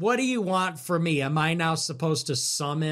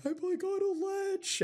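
much higher, softer mocking whimper; whiny suicidal friend; 7 dB quieter than usual. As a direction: imitate a friend whining on the ledge. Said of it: "and he was like".

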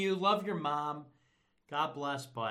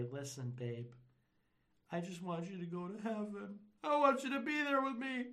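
mock-tender boyfriend; 5 semitones higher, 5 dB quieter than usual. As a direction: parody a gentle, devoted sweet-talk. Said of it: "take her by her hands, and say".

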